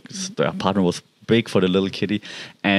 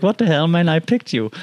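mock indignant girlfriend; 8 semitones higher than usual. Girlfriend jealous of the cat.

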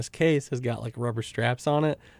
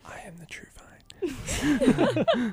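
mock true vine; much slower than usual. Mock-solemn quote breaking into laughter.

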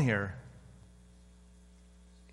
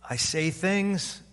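ordinary talk

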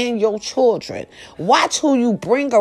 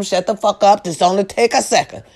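ordinary talk, nothing marked

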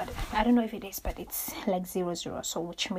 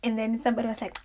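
ordinary talk

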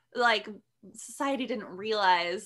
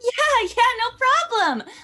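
put-on reassuring anime girl; 13 semitones higher, 7 dB louder than usual. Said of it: "how would the two respond".

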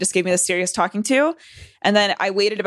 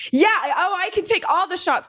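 much higher, mock-eager boast; cocky drunk bravado; 11 semitones higher than usual. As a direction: brag like a tipsy kid who can take anything. Drunk bravado answering the Marines.

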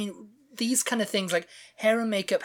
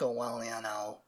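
a hint of George, pitched lower and softer; George's deep voice; 6 semitones lower, 9 dB quieter than usual. Describing [name]'s voice, lightly imitated lower. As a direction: lightly drop into George's low voice. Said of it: "deep voice".

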